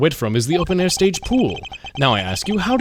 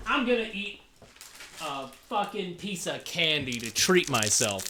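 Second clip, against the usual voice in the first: strained voice; 12 dB quieter than usual. Real, not acted. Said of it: "destroying my voice".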